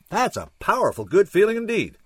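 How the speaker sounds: high-pitched, emphatic relish; hearty exclamation. A narrator's reading. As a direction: call it heartily across the room. Holler from across the room continues.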